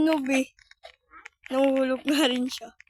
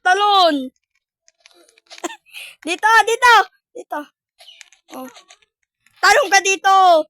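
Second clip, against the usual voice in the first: calling out; 4 semitones higher, 5 dB louder than usual. Real, not acted. Calling partner to spot.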